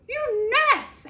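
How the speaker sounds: high, loud mock exclamation; the husband hooting that she's nuts; incredulous outburst. Husband's reaction retold.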